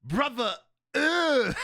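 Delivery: high, loud mock disgust; hammed-up high-pitched revulsion; meme impression. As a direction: play up the appalled "brother, ugh" meme. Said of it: "My favorite meme of".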